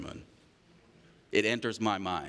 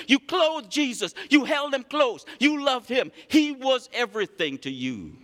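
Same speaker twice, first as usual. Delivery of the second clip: loud, rising pulpit preaching; fired-up preacher building to a shout; 7 dB louder than usual. Acted. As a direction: preach it out to the whole congregation. Preaches scripture at full force.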